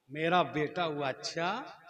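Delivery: normal talking voice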